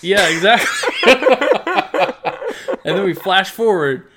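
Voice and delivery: loud, high laughter through words; laughing loudly. Real, not acted. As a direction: crack up while talking. Laughing at anime joke.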